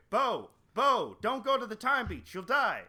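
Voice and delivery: calling out. Acted. Biden calling out in nightmares.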